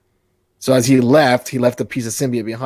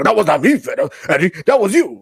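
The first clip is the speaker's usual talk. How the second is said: loud, high-pitched acted alien retort; put-on creature voice, pitched up and pushed; Venom; 5 semitones higher, 3 dB louder than usual. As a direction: play an indignant symbiote correcting its host. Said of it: "And Venom's like".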